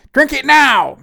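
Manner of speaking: high, loud shouted demand; furious shout. A narrator's reading. Pixie screams at him to drink it now, full shout. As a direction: yell it at full force, no patience left. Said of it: "she shouted".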